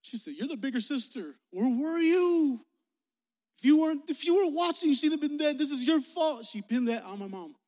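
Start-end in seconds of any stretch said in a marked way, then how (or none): 0.3-5.6 high, loud, angry blaming; acted accusation. Grandmother blaming mom for her sister's death, angry shout. 5.6-6.5 high, loud accusation; acted angry blame. Grandmother blaming mom for her sister's death, loud and angry.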